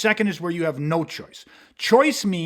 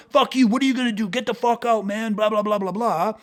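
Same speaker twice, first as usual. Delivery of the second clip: faster, higher mock-aggressive taunt; mock angry driver; 3 semitones higher than usual. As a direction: rattle off the rude guy's insults.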